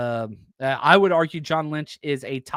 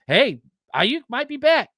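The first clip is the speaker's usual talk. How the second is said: mock front office; 8 semitones higher than usual.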